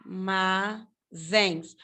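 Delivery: "Armazéns" very slowly as ar... ma... zéns; slow teacher syllabification of "Armazéns"; syllable by syllable. Shown as a demonstration. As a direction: break "Armazéns" into three syllables with long pauses. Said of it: "separar em sílaba".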